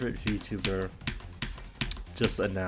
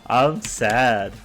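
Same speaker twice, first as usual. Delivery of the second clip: sad outburst; 4 semitones higher, 10 dB louder, slower than usual. Real, not acted. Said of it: "I'm fucking sad".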